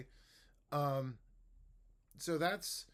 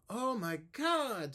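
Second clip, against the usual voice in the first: much higher, slow, drawn-out oh my God; exaggerated intonation; 11 semitones higher, 6 dB louder, slower than usual. Shown as a demonstration. Teacher draws big tune for student. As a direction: swoop the tune wide. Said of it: "mirror the direction".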